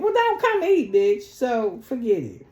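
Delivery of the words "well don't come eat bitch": high, loud sneering jab; mock yell. Sneering at the women skipping dinner, high and loud. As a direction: snap it out high and loud like a taunt.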